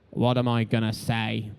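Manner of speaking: slow, low, lightly Irish drawl of a blank pundit; Eddie Jordan impression. Eddie Jordan asking his interviewer what to say, slow and low, lightly Irish. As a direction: lean in and ask it slowly, lightly Irish. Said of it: "I fear there may be an impression or two coming".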